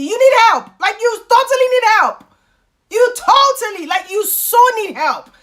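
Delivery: high, loud, insistent; emphatic scolding. Real, not acted. Vlogger telling dependent women off, loud and emphatic. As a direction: hammer it home at high pitch.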